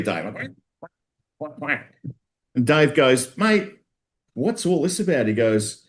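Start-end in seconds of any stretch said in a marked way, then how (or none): 0.8-1.9 mock duck quacking, quiet and pitched up; a hint of playful duck noises; duck quacks. Punchline imitating ducks. 3.4-5.3 high-pitched mock bewildered bloke; a touch of a baffled pub regular; puzzled mate. Dave's puzzled quote in joke.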